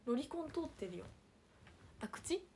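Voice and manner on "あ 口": sudden realization. Real, not acted. Realising the misread character.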